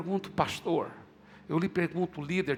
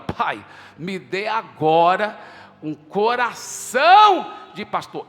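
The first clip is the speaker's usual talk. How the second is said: fervent prayer; 8 dB louder, slower than usual. Retold or imitated. Pastor retells praying for a heart.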